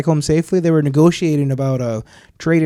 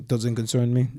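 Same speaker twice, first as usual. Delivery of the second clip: softly spoken mock Russian accent; 3 semitones lower, 8 dB quieter than usual. Imitating the coworker's curt answer, in a Russian accent. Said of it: "in a Russian accent".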